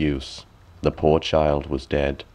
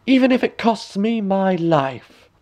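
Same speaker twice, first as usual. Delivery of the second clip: high raging queen; high vengeful vow; furious; 13 semitones higher than usual. A narrator's reading. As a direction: swear it in a fury. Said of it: "she cried".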